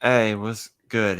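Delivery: ordinary talk, nothing marked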